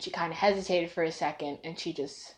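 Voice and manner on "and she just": normal talking voice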